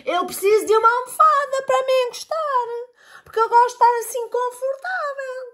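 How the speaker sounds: spoiled cat voice, high. A narrator's reading. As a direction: whine for a comfy cushion, high. Cat voice asking cushion.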